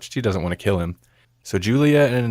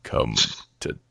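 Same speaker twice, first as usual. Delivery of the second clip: very low, slow, hushed creep; creepy daddy; 8 semitones lower, 5 dB quieter, slower than usual. Retold or imitated. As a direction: draw it out low and soft, imitating a creep. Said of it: "creepy thing ever, but just the way he says".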